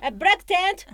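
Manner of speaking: put-on triumphant broken-English sales call, Japanese accent. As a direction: shout keywords in Japanese-accented English to get through. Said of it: "出川英語".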